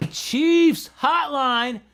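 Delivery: high, loud, drawn-out hype call; big loud radio-host greeting; excited shout-out. Real, not acted. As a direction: hype up a viewer joining the stream. Greeting a viewer by name.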